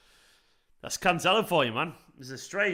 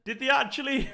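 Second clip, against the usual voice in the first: very high voice breaking into laughter; real, gleeful laugh of disbelief; incredulous laughing; 12 semitones higher than usual. Real, not acted. Fan hearing rivals drew nil nil, incredulous laughing.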